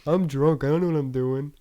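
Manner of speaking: hammed-up helpless drunk; mock drunk excuse. Drunk friend explains on the phone, hammed-up helpless drunk.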